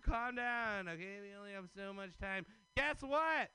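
usual speaking voice

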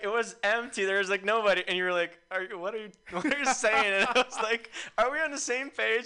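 high-pitched and loud, laughing through the quotes; laughing retelling. Retold or imitated. Laughing retelling of the bart mix-up.

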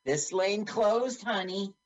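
slow high register ticks and cashier; a slow sing-song checkout lady. Retold or imitated. Imitating the cashier.

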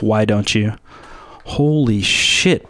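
ordinary talk

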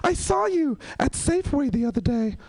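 high-pitched imitation of a child; mock schoolboy setting the teacher straight; insistent kid. Kid reporting a sighting to his teacher, high and insistent. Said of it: "he was like".

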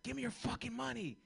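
a hint of a tough robber; mock robbery demand. Jumping out of bushes at the kid, mock tough demand.

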